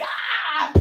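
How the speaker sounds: high startled yelp. Retold or imitated. Woman hearing the howl in the woods, high startled cry. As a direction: let out a short frightened cry. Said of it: "And I was like".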